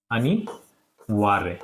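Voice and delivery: अनिवार्य stretched out very slowly, syllable by syllable; slow dictation. Shown as a demonstration. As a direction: dictate अनिवार्य slowly so pupils can write it. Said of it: "इस तरीके से ये अनिवार्य हो जाएगा". (अनिवार्य को बहुत धीरे, अक्षर-अक्षर खींचकर बोलें)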